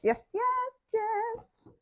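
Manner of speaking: high squeaky repeated yes; playful high-pitched yes. Real, not acted. Excited high-pitched yes while searching.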